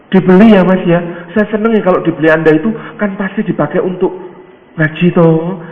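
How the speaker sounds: high, loud, eager seller's pitch; very enthusiastic. Acted. House seller urging a favoured buyer to purchase, very enthusiastic. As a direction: press the buyer with keen, pleading insistence. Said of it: "kayaknya semangat sekali".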